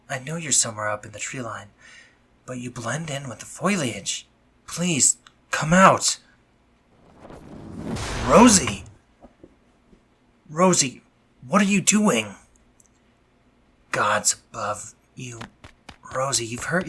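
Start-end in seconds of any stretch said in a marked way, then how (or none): 4.7-12.4 loud, high alarmed shout; frightened yell, pitch climbing; shouting her name. Acted. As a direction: call out in shock at what she is doing. Calls out on seeing her hurt.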